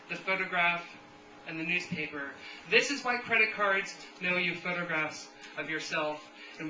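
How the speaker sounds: measured poem recital with punched openings; deliberate, emphatic live verse reading; poetry reading. Acted. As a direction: declaim it to a room, pausing between phrases. Reads a poem aloud to audience.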